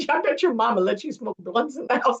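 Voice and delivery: lightly voiced [name] having fun; [name] impression. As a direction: tease her with a grin. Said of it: "He said".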